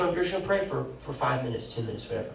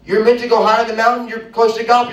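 high, loud and fast; preaching at full force; 7 semitones higher, 8 dB louder than usual. Real, not acted. Preacher urging the church higher, full force. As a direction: surge up the mountain image.

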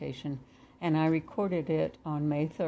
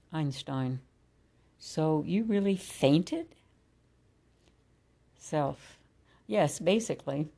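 guided meditation. Acted. Reads a channeled meditation dialogue.

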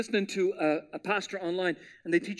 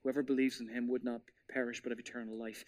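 soft scripture recitation; 7 semitones lower, 9 dB quieter than usual. Retold or imitated. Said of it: "The most famous verse".

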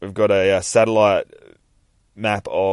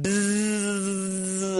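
high buzzing alarm-clock noise; imitated alarm buzz; 14 semitones higher than usual. Reading the comic aloud, mimicking the morning alarm with a high buzz.

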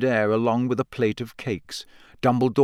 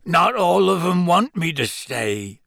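hoarse, raised-pitch protest; 6 semitones higher than usual. A narrator's reading. Gamekeeper doubting he is wanted, hoarse. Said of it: "said Hagrid hoarsely".

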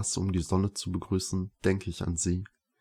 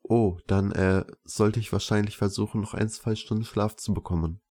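ordinary delivery, nothing marked

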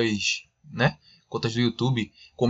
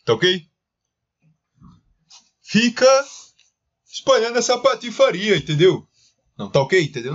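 high-pitched lisping politician; Bolsonaro voice; 4 semitones higher, 3 dB louder than usual. Retold or imitated. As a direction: raise the voice, lisp the s. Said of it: "quem faz o Emílio consegue fazer o Bolsonaro".

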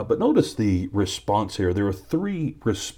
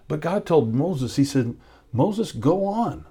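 fervent preaching; 7 semitones higher than usual. Acted. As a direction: proclaim God's word with conviction. Preaching climax voicing God.

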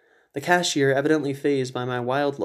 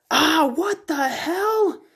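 drawn-out pained cry, much higher; wounded cry; 4 dB louder, much slower than usual. Acted. Shot hunter crying out in pain and shock. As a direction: wail it out as if just shot.

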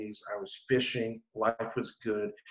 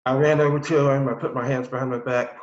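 ordinary delivery

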